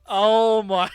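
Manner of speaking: loud, laughing astonishment; amused disbelief. Real, not acted. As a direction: burst out, loud and incredulous, with laughter. Incredulous at absurd ship name.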